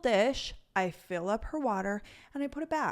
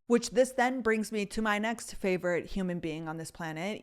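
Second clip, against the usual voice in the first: normal talking voice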